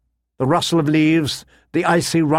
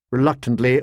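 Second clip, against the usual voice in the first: plain reading voice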